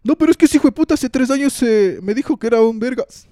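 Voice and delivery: loud, high, fast mock grudge rant; resentful rant. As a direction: play the bitter friend still fuming over old insults. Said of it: "la gente que se emputa con alguien".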